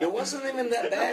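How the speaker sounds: high laughing voice. Real, not acted. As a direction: laugh while brushing it off. Laughing denial about beatings.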